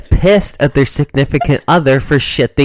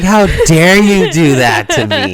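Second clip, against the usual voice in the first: loud, high, slow indignant cry; hammed-up wounded girlfriend; theatrical how-dare-you, louder and higher; mock outrage; 3 semitones higher, 7 dB louder, slower than usual. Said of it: "And you're like".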